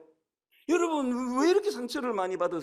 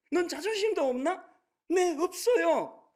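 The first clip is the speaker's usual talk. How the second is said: retold scolding and reply; 7 semitones higher, 4 dB louder than usual. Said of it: "자존심이 없으니까 상처를 안 받는 거예요".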